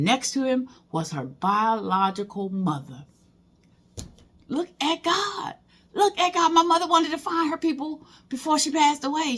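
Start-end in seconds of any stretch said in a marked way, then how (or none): 4.5-9.4 overjoyed praise. Real, not acted. Finding her biological grandmother online, real joyful praise. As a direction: marvel at the blessing.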